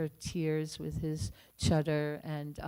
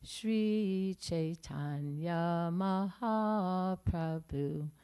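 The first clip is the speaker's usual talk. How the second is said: slow, solemn scripture recitation; slow, louder devotional chant-like reading; recited verse; 2 semitones higher, 4 dB louder, slower than usual. Acted. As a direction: intone it word by word like a prayer. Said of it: "right word for word".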